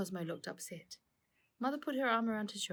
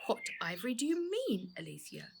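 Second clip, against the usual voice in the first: usual speaking voice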